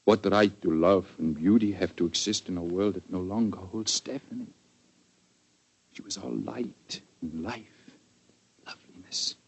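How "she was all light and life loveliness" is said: slow, much softer, fading into a whisper; hushed grief. Acted. Grieving count remembers his dead love, slow soft whisper. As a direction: breathe her name out like a memory.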